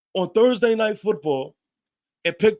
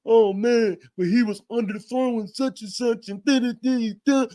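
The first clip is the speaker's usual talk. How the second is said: mocking critics; 4 semitones higher than usual. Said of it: "can't wait to say".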